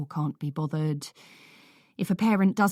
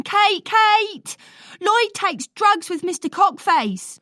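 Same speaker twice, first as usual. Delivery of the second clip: put-on much higher, loud child calling out; shouting; 7 dB louder, slower than usual. A narrator's reading. Boy running to tattle to Kate, shrill yelling. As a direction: shriek for the carer while running.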